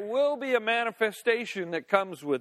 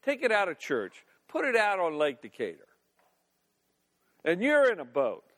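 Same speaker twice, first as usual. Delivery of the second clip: normal talking voice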